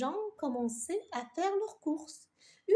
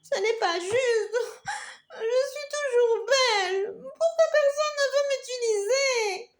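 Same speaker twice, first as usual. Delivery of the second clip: hurt, whimpering; 9 semitones higher, 6 dB louder than usual. Acted. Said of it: "vexée, murmura".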